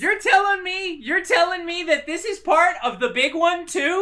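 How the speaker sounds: high, loud indignant disbelief; mock furious. Producer can't believe the island, furious. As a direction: build an outraged comic rant. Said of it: "he got real mad at that".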